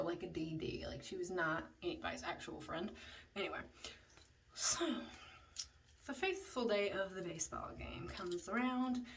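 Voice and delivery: ordinary delivery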